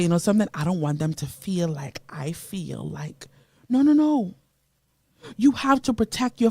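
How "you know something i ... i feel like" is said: secret whisper; whispered confession. Retold or imitated. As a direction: whisper it as if afraid to be heard. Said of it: "because you say".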